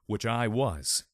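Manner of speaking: ordinary delivery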